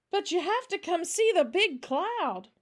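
put-on high, loud child's pleading; urgent child bursting with news; excited little girl. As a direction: insist they come and look.

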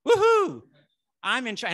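high, loud whoop into a declaration; shouting it out. Shown as a demonstration. Someone fills in the blank as invited, an exuberant shout. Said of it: "shout it out".

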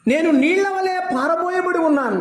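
high, ringing preacher's cry; preaching at full force. Acted. A preacher lifting his voice to the congregation. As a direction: proclaim it to the whole hall.

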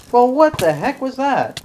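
startled gamer outburst; surprised outburst. Real, not acted. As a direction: react to the sudden glitch. Sudden lag spike surprise.

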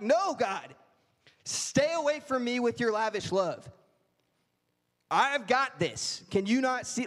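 whiny self-reliant believer pushing help away; stubborn refusal. Acted. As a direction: brush God off, insisting you've got it. Voicing stubborn believer refusing God.

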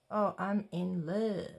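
ordinary talk, nothing marked

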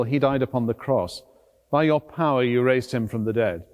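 usual speaking voice

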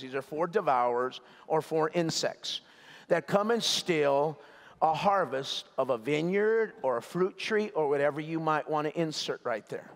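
ordinary delivery

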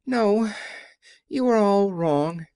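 high-voiced meek little man; a small, high, apologetic voice. A narrator's reading. The little man corrects the friends, meek and high. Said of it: "said the little man meekly".

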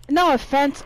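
ordinary talk

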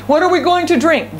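high-pitched imitation of a grumbling crowd; mock complaining. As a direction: whine it as a demand, pitched well up. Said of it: "And they complained".

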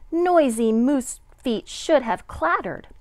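plain reading voice

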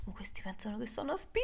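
quick unvoiced whisper; whispered panic. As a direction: hiss it fast to yourself in alarm.

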